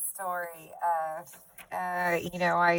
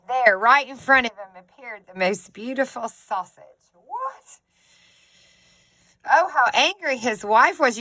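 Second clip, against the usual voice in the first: lively read-aloud, bright and animated; storybook reading; 5 semitones higher, 9 dB louder than usual. A narrator's reading. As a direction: read it to the kids with energy.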